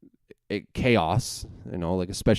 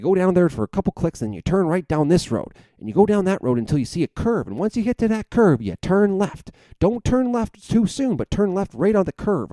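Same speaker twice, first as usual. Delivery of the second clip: high, pitched-up dad impersonation; dad giving directions; 10 semitones higher than usual. Retold or imitated. Dad warning son about the turn, high insistent mimicry. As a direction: mimic dad spelling out the route, voice way up. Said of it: "He's like".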